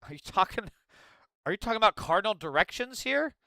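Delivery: ordinary delivery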